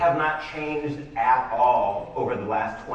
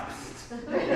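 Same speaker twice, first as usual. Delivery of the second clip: whisper; 11 semitones higher, 7 dB quieter than usual. Retold or imitated. Imagined shady email opener.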